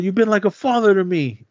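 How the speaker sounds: high imitated appeal; pleading. Boy to his father figure Sonny, high mock pleading.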